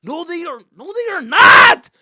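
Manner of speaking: very high, shouted exasperation; angry. Retold or imitated. Son arguing with dad about the onlookers, angry shouting. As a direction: shout in disbelief at a stubborn dad. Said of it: "I was getting so angry".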